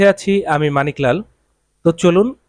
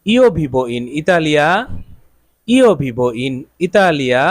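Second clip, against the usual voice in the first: "Io vivo in Italia" spoken very slowly with every word drawn out; slow and clear; much slower than usual. Shown as a demonstration. Teacher repeating the Italian sentence for students, very slow. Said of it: "Italian ভাষায় আমরা কি বলবো?".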